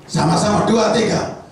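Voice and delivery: loud, high call to repeat together; leading the congregation. Shown as a demonstration. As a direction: count them in and chant slowly. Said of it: "Sekarang ganti". (memimpin jemaat mengucapkan bersama dengan lantang)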